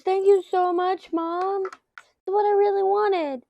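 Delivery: put-on high, slowed, gushing kid voice; excited son. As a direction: imitate a thrilled twelve-year-old thanking mom. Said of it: "He goes".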